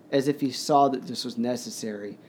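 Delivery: normal talking voice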